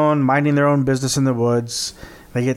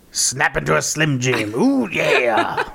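Slim Jim ad voice; slower than usual. Retold or imitated. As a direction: ham up the Slim Jim catchphrase. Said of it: "it's gonna scream in your ear".

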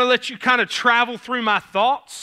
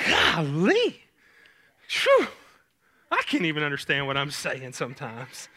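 exaggerated southern twang; 5 semitones lower, 3 dB quieter than usual. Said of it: "look past my southern accent".

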